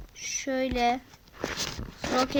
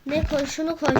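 ordinary talk, nothing marked

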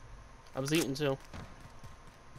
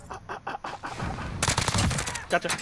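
ordinary talk, nothing marked

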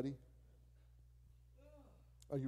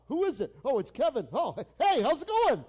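much higher and louder, delighted to hear from a friend; glad greeting; 12 semitones higher, 15 dB louder than usual. Retold or imitated. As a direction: perk up happily at the caller. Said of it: "they'll pick up".

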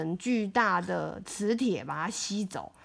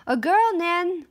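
ordinary delivery, nothing marked

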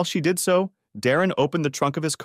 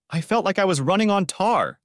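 breathless, high and shaken; breathless gasp; 9 semitones higher than usual. A narrator's reading. Shannon gasping to Darren after her escape. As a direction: gasp it out, still shaking from the chase. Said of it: "she gasped".

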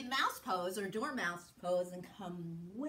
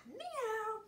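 playful cat meow; 9 semitones higher than usual. Pretend. Teacher playing cat for children, playful high meow. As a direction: mew lightly like a cat.

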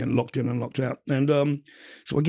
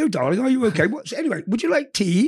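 much higher, out-of-touch doting mother; sing-song it, offering tea as if nothing happened; imitating mum; 9 semitones higher, 3 dB louder than usual. Mum offering her jailed son tea, mock doting.

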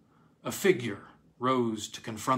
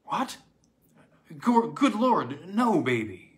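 high, louder, stammered shock; horrified; 4 semitones higher, 6 dB louder than usual. A narrator's reading. Father answers his little girl's news, horrified. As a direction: recoil in horror, stumbling into the words. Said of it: "said her father, horrified".

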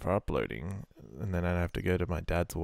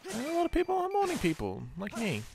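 sarcastic; 12 semitones higher than usual. Acted. Claims to be a morning person.

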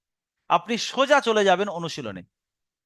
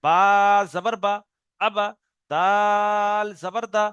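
each letter and vowel drawn out slowly; slow spelling out; much slower than usual. Shown as a demonstration. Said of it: "এর পুরো বানান Ezra করবেন". (ধীরে ধীরে বানান করে পড়া)

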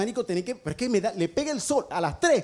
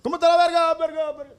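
faux écho; 6 semitones higher, 4 dB louder than usual. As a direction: question criée puis répétée plus faible. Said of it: "estoy jugando con el eco".

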